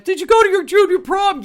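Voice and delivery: high, loud imitation of excited askers; mock nosy questioning. Classmates all asking about her junior prom, mock-eager and high. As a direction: pipe up loudly, mimicking everyone.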